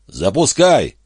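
shouted order from down below; calling out. Acted. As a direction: call up to someone far overhead. Calls up to Эндерс from pit.

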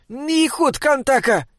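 high-pitched call to a wolf; cried command. A narrator's reading. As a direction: call out the order high and slow. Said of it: "крикнул Бинобик".

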